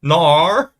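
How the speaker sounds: NAR loud, high and drawled Aussie; exaggerated Australian no; mock Australian accent. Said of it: "That's Australian".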